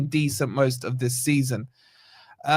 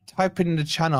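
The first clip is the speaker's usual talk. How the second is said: normal talking voice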